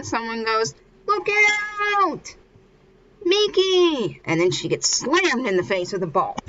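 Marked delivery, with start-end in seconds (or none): 1.1-4.2 high, drawn-out mock yell; shouted warning. Someone warns Miki of a flying ball, acted shout. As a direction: call out long and high as a warning.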